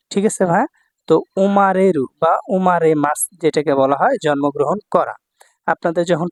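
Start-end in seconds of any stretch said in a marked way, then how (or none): none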